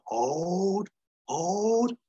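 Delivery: drawn-out rising "hold"; Major's held command. Acted. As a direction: stretch the order upward, building suspense. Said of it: "the Major's pure".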